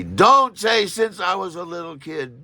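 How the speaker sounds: frantic inner voice shouting a slow warning; inner scream. Retold or imitated. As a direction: yell the warning at yourself, slowly. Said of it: "my mind screams at me".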